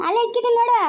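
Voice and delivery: impatient-sounding household shout; calling back. Acted. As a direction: call it back high and loud. Calling back to someone asking for clothes.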